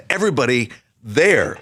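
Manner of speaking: ordinary talk, nothing marked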